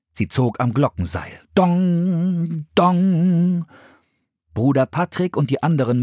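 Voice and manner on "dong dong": high, sustained ringing dong; held, resonating bell sound; imitated bell toll. A narrator's reading. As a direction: ring out like a bell. Narrator imitates the bell being rung.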